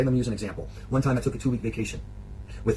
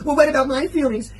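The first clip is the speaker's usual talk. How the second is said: high, loud imitation of a wounded partner; mock wounded outburst; 12 semitones higher, 6 dB louder than usual. Man mimics partner turning his explanation around, mock wounded. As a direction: whine it out in exaggerated hurt. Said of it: "Like this, right?".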